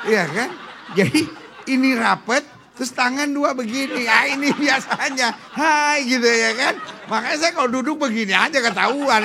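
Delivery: loud, laughing sermon banter; loud comic preaching. Acted. Preacher joking loudly to the congregation, laughing. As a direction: play it big for the congregation, laughing.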